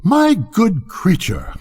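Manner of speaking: haughty rocket, drawn out. Acted. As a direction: look down on her from a height. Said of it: "in a very haughty tone of voice".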